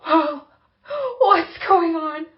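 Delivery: high, slow, bewildered and a bit loud; confused. Retold or imitated. Retelling her own confused reaction, high and bewildered. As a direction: sound lost and alarmed, half laughing. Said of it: "And I go".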